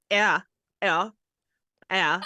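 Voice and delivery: put-on Canadian "yeah"; Canadian impression. Showing co-hosts what Canadians sound like, Canadian accent. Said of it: "That's what I think Canadians sound like".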